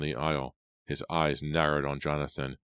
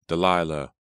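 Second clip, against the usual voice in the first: loud, anguished call of a name; choked scream; 6 dB louder than usual. A narrator's reading. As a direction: cry out as if choked. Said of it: "screamed her name in a choked voice".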